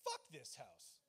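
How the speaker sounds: mock fed-up. Elation turned to disgust with house.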